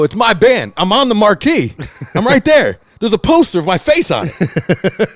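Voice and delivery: loud, high, laughing disbelief; half-shouting through a laugh at the bouncer; indignant protest. Retold or imitated.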